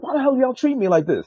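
high-pitched indignant complaint; heated retold protest; getting mad. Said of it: "I start to get mad".

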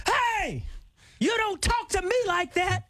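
high, slow yelled rebuke; mock furious shout; Richard's angry yell. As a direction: snap back at the caller. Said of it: "and he goes".

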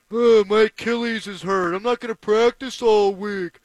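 lightly voiced whiny excuse, mocking; a hint of a whining star athlete; mock whiny Brady. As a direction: sneer a bit as a complaining old quarterback.

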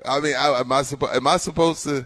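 ordinary talk, nothing marked

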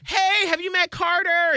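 put-on a proud grandma showing off the baby; high friendly grandmother voice; cheerful mom. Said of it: "her mom was like".

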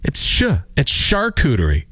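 'charcuterie' begun with a soft sh; soft sh correction. Retold or imitated. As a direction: say 'charcuterie' as shar-KOO-ter-ee. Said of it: "He says, "It's not ch, it's sh".

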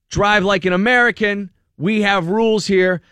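angry man yelling at a driver, scolding; retold yelling. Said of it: "screaming at the".

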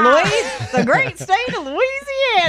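high-pitched, showy state-name cheer; playful cheering echo. Pretend. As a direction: echo the caller in a high, drawn-out whoop. Host cheers back the caller's guess.

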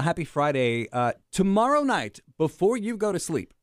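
ordinary talk

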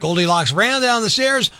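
lively read-aloud storytelling; story narration. A narrator's reading. Reading a story to kids.

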